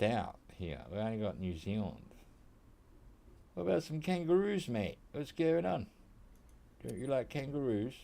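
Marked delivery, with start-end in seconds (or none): 3.5-7.9 mock Aussie shout. Mock Aussie calling out kangaroos.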